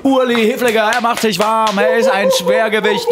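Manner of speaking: booming high ring announcer voice; slow, drawn-out fight introduction; boxing announcer. Pretend.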